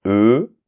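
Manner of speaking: E said as eu; E as the unaccented letter, French eu; plain E sound. Shown as a demonstration. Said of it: "il y a une différence quand même entre".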